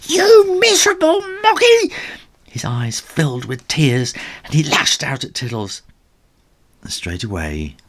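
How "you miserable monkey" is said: high loud furious shout; furious yell. Acted. Ginger loses all control at Tiddles, furious shout. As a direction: scream it at Tiddles.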